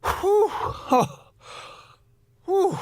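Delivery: high breathy whew of relief; whistled sigh of relief. Acted. Eiffel alone after dodging the physical, relieved whew. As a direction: blow out the tension, relieved.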